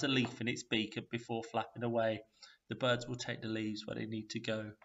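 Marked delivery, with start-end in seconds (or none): none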